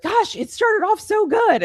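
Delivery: high-pitched, drawn-out mock optimism; hopeful fan reaction. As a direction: gush slowly like a hopeful fan. Quotes fans' hopeful early-season reaction.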